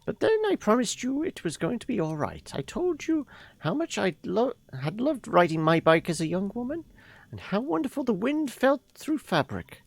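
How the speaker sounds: put-on high, airy old woman voice; a fond grandmother reminiscing; old lady voice. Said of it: "So we should do the old lady voice".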